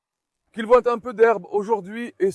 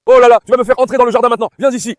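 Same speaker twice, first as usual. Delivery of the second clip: exasperated outburst; 4 semitones higher, 8 dB louder, much faster than usual. Real, not acted. Scolding puppies running into garden.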